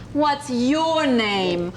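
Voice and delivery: slow, "your" leaned on hard; "What's your name?" pressing on "your"; stress on your. Shown as a demonstration. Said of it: "with the emphasis on your".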